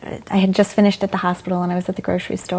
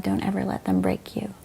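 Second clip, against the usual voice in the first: soft whisper, much quieter; whispered comfort; 7 dB quieter than usual. Retold or imitated. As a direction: hush it close to her ear. Midwife comforting her on floor.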